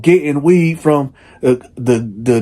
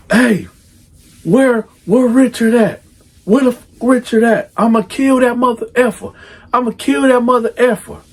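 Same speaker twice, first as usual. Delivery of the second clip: 1 angry yell; 10 semitones higher than usual. Retold or imitated. Angry father calling about daughter.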